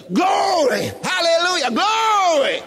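high cries of praise; Spirit-moved shouting. Retold or imitated. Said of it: "We are moved by the Spirit".

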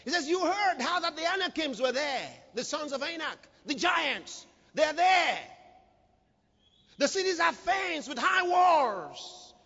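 high-pitched, declaimed scripture; full-force preaching. Acted. Preacher voicing Caleb asking for this mountain, forceful high preaching. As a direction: proclaim the verse boldly to the crowd.

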